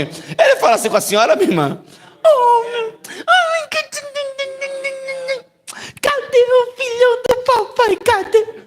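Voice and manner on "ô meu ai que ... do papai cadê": put-on very high, slow cooing baby talk; mock baby talk. Preacher mocking a Spirit cooing to Nicinha in baby talk. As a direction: gush over her like a baby. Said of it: "Eu não imagino".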